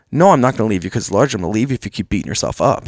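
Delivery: quick mock wife retort. Wife's answer voiced in story.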